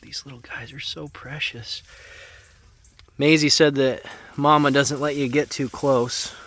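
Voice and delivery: hushed whisper near piglets. Real, not acted. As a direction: whisper so you don't wake them. Near sleeping newborn piglets.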